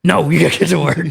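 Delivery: stern dad. Retold or imitated. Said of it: "looking at him like".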